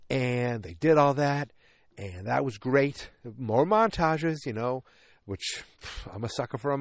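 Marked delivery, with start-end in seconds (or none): none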